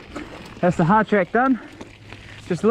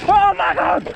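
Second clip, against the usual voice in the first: alarmed exclamation; 8 semitones higher, slower than usual. Real, not acted. Exclaims while sliding in mud.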